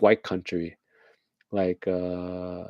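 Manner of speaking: normal talking voice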